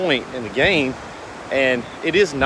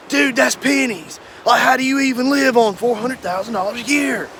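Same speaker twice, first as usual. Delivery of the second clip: Grant Cardone voice; 9 semitones higher than usual. Retold or imitated. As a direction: sneer at the salary like a hustle coach. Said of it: "I'm gonna do my Grant Cardone voice".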